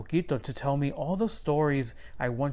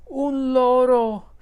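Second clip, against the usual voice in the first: slow, high, loud cry of delight; delighted gasp; 11 semitones higher, 9 dB louder, slower than usual. A narrator's reading. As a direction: greet the parrot with wonder. Abuela delighted with parrot.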